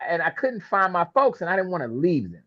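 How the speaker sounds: ordinary delivery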